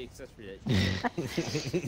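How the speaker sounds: laughing through the words. Real, not acted. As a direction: crack up while saying it. Laughing while joking.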